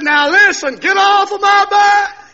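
loud, high, drawn-out holler; shouted rebuff. Retold or imitated. Man telling off the persistent preacher, shouting. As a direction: shout him away, stretching the last words.

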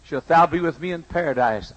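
normal talking voice

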